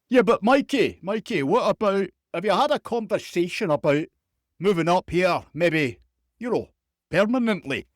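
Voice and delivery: mock Scottish interviewer, wheedling and insistent; Scottish accent. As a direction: press the question in a put-on Scottish accent. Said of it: "some of my Scottish accent here".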